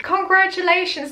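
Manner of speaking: high, sing-song mock congratulations; mock cheerful uncle. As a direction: chirp it like the cheery rich uncle. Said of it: "he's all like".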